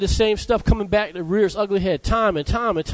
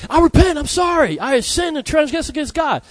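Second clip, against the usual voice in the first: pleading, much higher, anguished cry of repentance; remorseful plea; 7 semitones higher than usual. Retold or imitated. David confessing to the prophet, remorseful and pleading. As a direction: cry out sorry as if caught red-handed.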